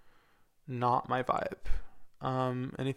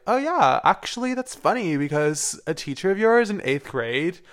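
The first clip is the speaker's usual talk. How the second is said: surprised mom voice; 5 semitones higher, 5 dB louder than usual. Retold or imitated. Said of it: "she's like".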